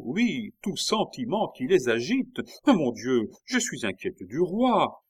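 tirade véhémente, forte et aiguë; sorte de fureur. A narrator's reading. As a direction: laisser déborder la fureur de la reine. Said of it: "avec une sorte de fureur". (avec fureur)

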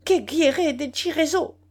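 high, loud foreign-tongue exclamation; startled outcry. A narrator's reading. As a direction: burst out in indignant surprise. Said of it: "s'écria-t-il".

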